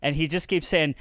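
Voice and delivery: ordinary delivery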